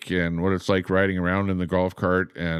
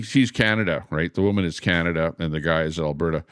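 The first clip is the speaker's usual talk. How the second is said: ordinary delivery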